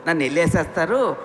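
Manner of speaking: ordinary delivery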